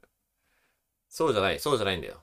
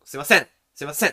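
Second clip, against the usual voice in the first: loud, higher call hit hard; punchy repeated hail; forceful burst; 4 semitones higher, 8 dB louder, faster than usual. Shown as a demonstration. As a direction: shove the call out with sudden force. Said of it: "こう、ガッと".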